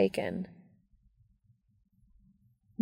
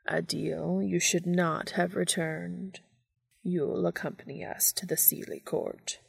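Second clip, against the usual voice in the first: cold sweet ancient calm; 5 dB quieter than usual. A narrator's reading. Fairy male speaks impassively.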